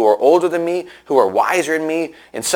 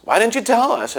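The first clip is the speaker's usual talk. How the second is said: high mock yell of blame; stranded driver yelling at neighbors, mock angry; 10 semitones higher than usual. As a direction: imitate the driver's outrage, pitched up. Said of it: "starts yelling at us".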